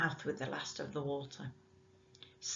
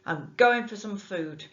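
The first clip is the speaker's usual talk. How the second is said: louder barked order from the bargeman; a hint of a rough boatman giving orders; 4 semitones higher, 8 dB louder than usual. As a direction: snap the order at the boy. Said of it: "he ordered".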